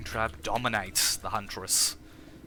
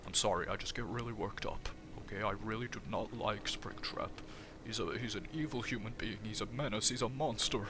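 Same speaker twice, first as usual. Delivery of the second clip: softer put-on voice, worked up; Fredbear; 6 dB quieter than usual. Fredbear apologising after being scolded for swearing, soft character voice. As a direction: answer as a chastened but resentful sidekick, softly. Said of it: "Hey, Fredbear".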